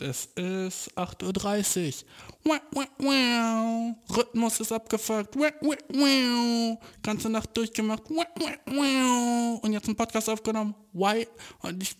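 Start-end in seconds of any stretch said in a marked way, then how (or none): 2.4-3.9 high, drawn-out whiny wah; mock whining wah. Real, not acted. Overtired whining after an all-nighter. 5.4-6.8 high, drawn-out whiny wah; mock whining wah. Real, not acted. Overtired whining after an all-nighter. 8.1-9.6 high, drawn-out whiny wah; mock whining wah. Real, not acted. Overtired whining after an all-nighter.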